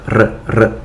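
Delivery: R trilled with the tongue tip, a quick rolling burr; rolled R. Shown as a demonstration. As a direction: roll the R, tongue tip fluttering. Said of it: "you should be able to produce the sound R".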